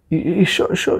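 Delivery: ordinary talk, nothing marked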